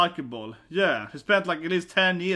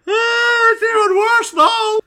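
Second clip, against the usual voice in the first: very high, loud cry of dismay; high, loud mock-horrified shriek; shocked wail; 8 dB louder, slower than usual. Real, not acted. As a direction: wail it like you can't believe your eyes. Reacting to scene on screen.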